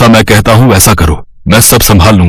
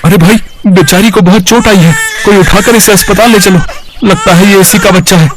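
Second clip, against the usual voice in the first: higher voiced bystander calling for help; concerned passerby; 8 semitones higher than usual. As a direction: call out urgently to the crowd. (घायल औरत देखकर राहगीर मदद के लिए पुकारता है, ऊँची आवाज़ में)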